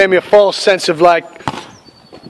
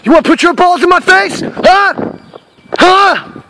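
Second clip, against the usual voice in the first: angry taunting; 12 semitones higher, slower than usual. Acted. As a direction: get in his face and dare him. Challenging friend aggressively.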